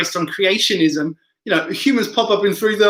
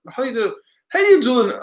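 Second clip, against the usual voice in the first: failed try at a northern English accent, pitched up; 6 semitones higher than usual. Shown as a demonstration. Streamer practising before giving up, attempted northern English accent. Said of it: "I can't do it at the moment".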